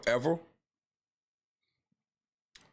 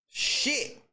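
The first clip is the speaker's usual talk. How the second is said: high strained curse; pained curse; 11 semitones higher than usual. Real, not acted. Fan cursing the buzzer-beater, high and strained. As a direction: spit it out in disbelief.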